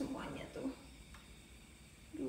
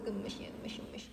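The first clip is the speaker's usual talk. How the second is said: whispered gemes; 7 semitones lower, 5 dB quieter than usual. Real, not acted. Cooing at pet.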